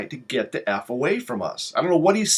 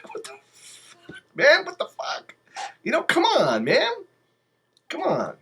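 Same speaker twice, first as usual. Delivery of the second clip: breathless laugh-spoken outrage; amused what-the-fuck; laughing disbelief; 12 semitones higher than usual. Real, not acted. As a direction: squeeze it out through laughter.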